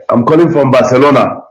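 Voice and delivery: ordinary talk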